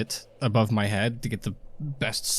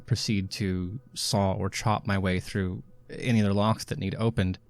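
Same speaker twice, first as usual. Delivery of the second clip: normal talking voice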